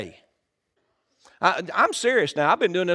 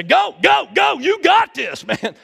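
much louder, higher cheering shout; shouted encouragement; 10 semitones higher, 9 dB louder, slower than usual. Retold or imitated. Handing the baton to a teammate, shouting go go go. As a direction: yell it like a relay teammate. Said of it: "hand off the baton and say".

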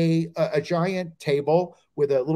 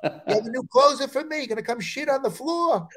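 higher-pitched, hammed-up indignant complaint; mock angry outburst; 8 semitones higher than usual. Comic telling a club story, mock indignation. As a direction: play it like an exasperated rant.

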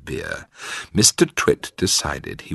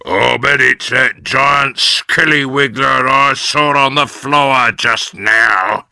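put-on louder, slow sly old man; Mister Twit; 6 semitones higher, 6 dB louder than usual. A narrator's reading. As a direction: spin the tale slowly to scare her. Husband scaring his wife.

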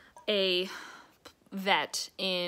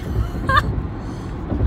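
loud laugh; 9 dB louder than usual. Real, not acted. Laughs at missing her exit.

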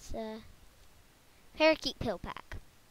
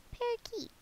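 parakeet voice; 8 dB louder, much slower than usual. Pretend. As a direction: chirp it like a little parakeet. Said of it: "That's my parakeet voice".

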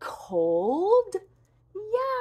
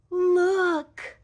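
ordinary talk, nothing marked

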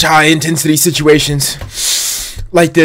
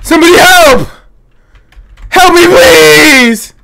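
panicking gamer; 8 semitones higher than usual. Pretend. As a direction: beg the room for rescue. Calling for help in game.

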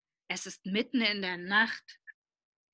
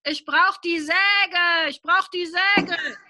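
higher, louder, slow pleading; panicky child; 7 semitones higher, 5 dB louder than usual. Acted. Boy pretending to be trapped, pleading loudly for the saw. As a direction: beg for the saw in mock panic. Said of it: "schreit Willi".

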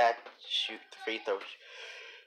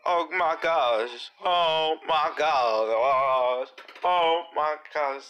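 slow, drawn-out amazed oh my gosh; stretched amazed exclaim; 5 semitones higher, 5 dB louder, much slower than usual. Real, not acted. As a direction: stretch it out in disbelief. Reacting to a crossover.

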